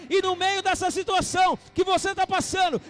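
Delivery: shouting, fervent sermon climax; rally the congregation with a loud call; full-force preaching. Acted. (pregação inflamada aos gritos)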